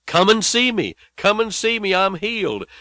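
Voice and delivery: high calling shouts, full of joy; joyful yell. Retold or imitated. Son calling his parents from the village, high joyful yell. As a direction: call out high to the family across the village. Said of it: "he yelled to his mother and to his father".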